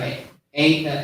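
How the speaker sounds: ordinary talk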